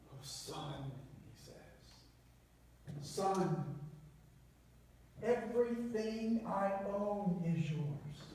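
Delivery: soft, slow and tender; gentle fatherly reassurance, barely above a hush. Retold or imitated. Loving father comforting his angry son, soft and tender.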